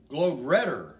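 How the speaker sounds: ordinary delivery, nothing marked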